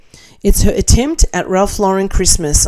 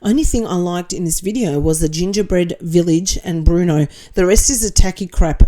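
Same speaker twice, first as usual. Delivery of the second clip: ordinary talk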